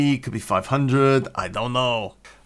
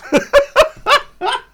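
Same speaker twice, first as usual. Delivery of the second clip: loud high cackle of laughter; laughing; 9 dB louder than usual. Real, not acted. Teller cracking up at the punchline, loud laughter. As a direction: burst out laughing at your own joke.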